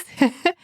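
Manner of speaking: brief, high chuckle; short laugh. Real, not acted. Podcaster amused by own joke to listeners, short chuckle.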